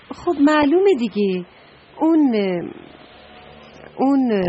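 high, loud insisting; emphatic outburst. Acted. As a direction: blurt it out as if it's obvious. Trash can explains, emphatic.

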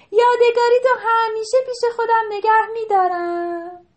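high, loud joyful shout to the sea; loud happy call. A narrator's reading. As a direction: call out across the waves in delight. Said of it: "بلند داد کشید که". (فریاد شاد و بلند)

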